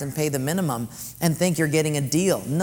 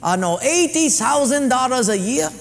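higher, louder mock Japanese accent boss; broken-English mock Japanese accent, pitched up; Mister Sato; 9 semitones higher, 5 dB louder than usual. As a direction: play the sushi owner in a mock Japanese accent. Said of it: "He said".

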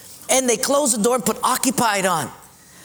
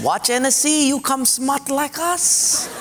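slow, higher, sly mock Japanese accent; 5 semitones higher, slower than usual. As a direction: draw it out like a smug Japanese traveller. Japanese guy boasts again.

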